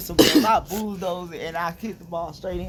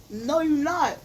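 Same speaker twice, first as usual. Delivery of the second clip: higher, louder retort; indignant retort; 9 semitones higher, 4 dB louder, much slower than usual. Pretend. Boy denying his friend the points, indignant. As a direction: snap back in protest.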